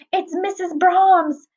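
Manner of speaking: high, drawn-out cry of alarm; alarmed exclamation. A narrator's reading. Superintendent spotting the frantic woman, alarmed cry. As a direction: call it out high and slow. Character exclaims at sudden commotion.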